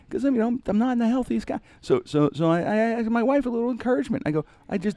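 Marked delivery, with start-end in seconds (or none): none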